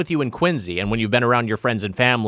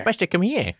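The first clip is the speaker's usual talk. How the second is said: higher mock Boston call; 8 semitones higher than usual. Shown as a demonstration. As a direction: copy the clip's Boston voice. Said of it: "You sound very Boston".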